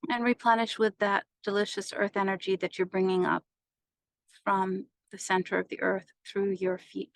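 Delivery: even meditation voice with long pauses; guided meditation. Acted. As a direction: guide them through each breath, unhurried.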